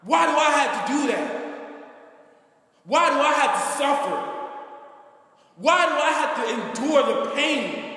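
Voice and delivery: crying out to God in pain; anguished cry to God. Retold or imitated.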